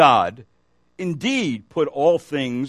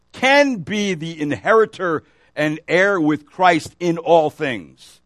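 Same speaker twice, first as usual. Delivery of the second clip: higher, louder preaching voice, slowing on key words; 4 semitones higher, 4 dB louder than usual. Acted. Preacher urging the congregation about sin, full preaching voice. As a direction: drive the point home from the pulpit.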